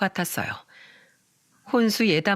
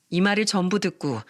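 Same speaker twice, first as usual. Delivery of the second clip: ordinary delivery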